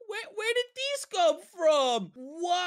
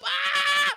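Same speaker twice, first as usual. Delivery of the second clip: short high loud shriek; frightened scream; 10 semitones higher, 6 dB louder than usual. Acted. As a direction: cry out in sudden fright. Startled by monster again.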